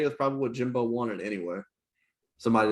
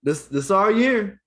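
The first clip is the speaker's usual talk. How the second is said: higher, louder, slow mock fan cry; Cowboys fan boast; 8 semitones higher, 7 dB louder, slower than usual. Mocking Cowboys fans with a loud slow boast. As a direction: shout it like a deluded fan. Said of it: "You know their favorite line?".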